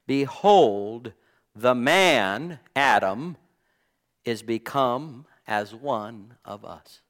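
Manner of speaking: Behold punched loud, each phrase weighed; re-read the verse slowly, landing on "Behold" and "the man"; emphatic rereading. Shown as a demonstration. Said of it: "really think about what was said there".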